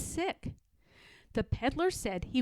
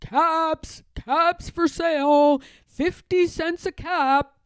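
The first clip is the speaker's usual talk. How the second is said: peddler's street call, higher and louder; hawker's sing-song cry; calling out wares; 5 semitones higher, 7 dB louder than usual. Acted. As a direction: call out to sell caps. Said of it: "he began calling".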